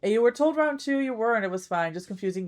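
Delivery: ordinary delivery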